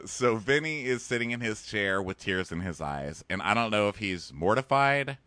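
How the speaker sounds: ordinary talk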